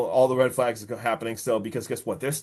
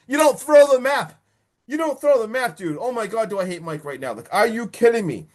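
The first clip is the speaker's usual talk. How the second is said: high-pitched, indignant outburst; exasperated scolding; 9 semitones higher, 4 dB louder than usual. Real, not acted. Viewer ranting at Mike over the map, exasperated. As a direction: scold him in disbelief, voice jumping up.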